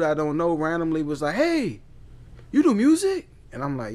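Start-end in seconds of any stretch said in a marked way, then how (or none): 1.3-3.3 high, rising call to a stranger. Retold or imitated. Random dude at his job asks, high eager call-out. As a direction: imitate someone hailing him from across the way.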